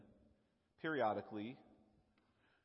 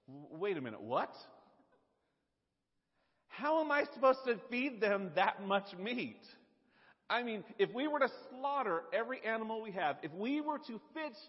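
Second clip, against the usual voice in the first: much higher, louder disbelieving protest; incredulous panic; 8 semitones higher, 4 dB louder than usual. Retold or imitated. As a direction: sputter in disbelief at an impossible task. Moses panicking at God's promise.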